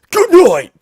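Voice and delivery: high, loud mock Batman shout; Batman punchline. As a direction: yell it like a hero landing a kick. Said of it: "drop kicks him and goes".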